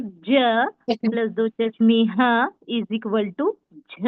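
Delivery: very slow, spelled-out equation; slow sound demo. Shown as a demonstration. Teacher showing the class the sound झ, slowly. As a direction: hold each sound so they can follow. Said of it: "फिर देखिए".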